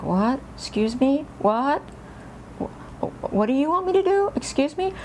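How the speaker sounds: high, sharply rising puzzled questions; imitating confusion. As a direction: ask as a baffled child would. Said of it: "I had no idea what she was meaning".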